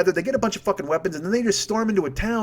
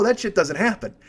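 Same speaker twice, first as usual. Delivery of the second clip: ordinary delivery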